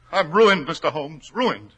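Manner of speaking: loud, high wail of despair; broken man crying out to Holmes; anguished cry. Acted. Despair at ruin.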